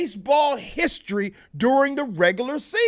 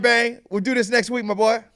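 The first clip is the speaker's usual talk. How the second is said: ordinary delivery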